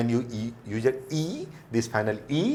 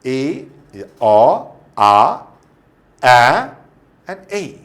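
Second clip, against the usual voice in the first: each vowel called out loud; loud vowel drill; 3 semitones higher, 13 dB louder, much slower than usual. Shown as a demonstration. Teacher summing up vowel examples, loud calls. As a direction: call each letter sound to the room. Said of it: "I've given the example".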